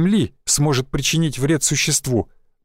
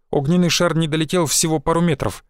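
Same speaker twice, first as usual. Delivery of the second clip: ordinary delivery, nothing marked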